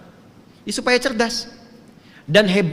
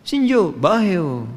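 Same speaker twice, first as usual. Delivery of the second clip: slow, drawn-out Libyan Arabic greeting; lightly imitated Libyan Arabic; Libyan dialect; 5 dB louder, much slower than usual. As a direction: stretch it out like a Libyan local. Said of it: "Orang Libya bilangnya".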